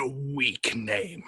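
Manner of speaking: slow, ominous disdain; dark lord voice. Acted. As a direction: draw it out like a scornful god. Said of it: "very well in the voice".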